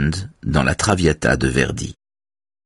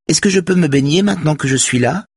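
high-pitched, moderately raised call; 10 semitones higher than usual. A narrator's reading. Marie-Louise calls after Véronique to swim, high call. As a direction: call after someone walking away. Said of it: "crie Marie-Louise".

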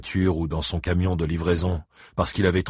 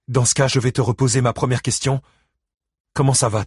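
higher, insistent questioning; persistent ex-wife; 6 semitones higher, 4 dB louder than usual. Acted. Christine repeats her first question to Buddy Lee, high and insistent. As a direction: ask it firmly, refusing to let go.

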